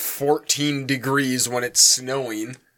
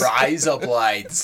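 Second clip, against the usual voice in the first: put-on Australian accent; much slower than usual. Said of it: "Is what I'm doing Australia?".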